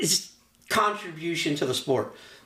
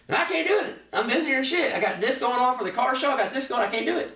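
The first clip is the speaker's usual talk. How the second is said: higher, brushing-off mock refusal; lightly voiced busy friend; 7 semitones higher than usual. Lightly imitating Rick turning down a favour, higher brushing-off voice. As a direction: wave him off, too busy. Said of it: "He goes".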